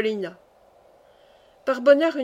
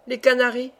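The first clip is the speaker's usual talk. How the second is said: ordinary delivery, nothing marked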